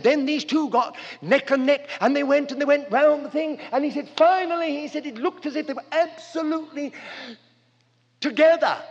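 breathless neck-and-neck excitement; excited race retelling. Retold or imitated. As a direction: call it like the finish is happening now.

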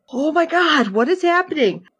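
high-pitched mock-panicked exclamation; hammed-up alarm, pitched high; retold panic. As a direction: relive freaking out at the screen. Retells panic while watching show.

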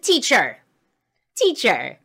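high, loud retold call for teacher. Child summons teacher to settle cousins' argument, calling out. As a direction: call across the classroom like an indignant kid.

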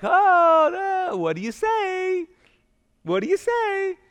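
very high, stretched-out mom voice; exaggerated parent's cue; prompting mom. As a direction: sing the prompt out high to a child. Voices mom prompting her child.

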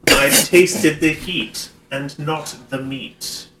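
high, loud, slow put-on declaiming; hammed-up letter read aloud in measured phrases; mock letter-writer. Co-host continuing the pretend letter to Hannibal, slow mock-grand voice.

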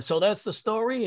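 hoog geroepen vraag naar het podium; high called question. Retold or imitated. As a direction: roep het hoog als een vraag. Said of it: "they'd yell".

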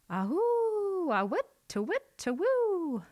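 high hooting owl call. A narrator's reading. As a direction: hoot it like an owl. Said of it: "said the owl".

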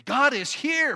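proclaimed with force; loud. Shown as a demonstration. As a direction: raise it to the room.

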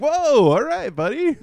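very high, loud startled whoa; mock taken aback. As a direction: back off in playful mock alarm. Backs off from angry friend.

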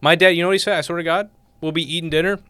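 ordinary talk, nothing marked

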